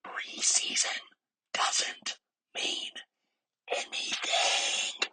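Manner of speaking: drawn-out whisper with deliberate breaks; slow emphatic whisper. Shown as a demonstration. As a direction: whisper it again slowly, pausing between chunks. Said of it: "whisper it for you again for the people in the back".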